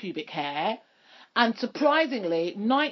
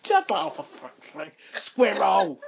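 Jonathan Ross; 3 semitones lower, slower than usual. Retold or imitated. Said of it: "I sound like Jonathan Ross".

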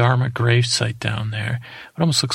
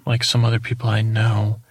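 ordinary talk

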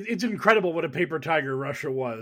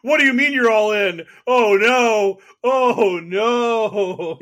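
high, loud cartoon panic; hammed-up mock panic; 6 semitones higher, 7 dB louder, much slower than usual. Acting out Putin losing all in, loud mock wail. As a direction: wail it like a caught-out villain.

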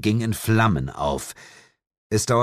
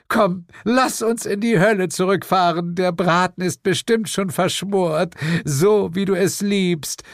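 high, sweet, affectionate coaxing; doting Lilith; 12 semitones higher, 3 dB louder than usual. A narrator's reading. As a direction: coax a partner home, slowing at the end. Lilith fondly invites devil home. (hoch und zärtlich lockend)

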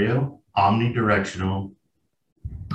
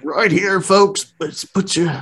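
a lightly voiced, much higher show host; mock host voice; 10 semitones higher, slower than usual. Still doing the host bit for the cohost, high mock announcer. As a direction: call to the folks like a showman. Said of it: "Try to do a".

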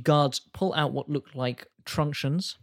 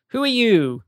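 high, loud shout from above; shouted challenge; 9 semitones higher, 9 dB louder than usual. Acted. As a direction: yell down at the intruders. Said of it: "shouts down at you".

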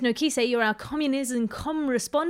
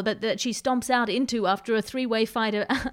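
ordinary talk, nothing marked

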